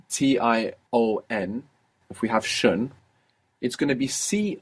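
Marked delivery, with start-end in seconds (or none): none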